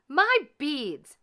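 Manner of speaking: high loud outrage; indignant; outraged cry. A narrator's reading. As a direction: Anastasia spotting her beads. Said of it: "cried Anastasia".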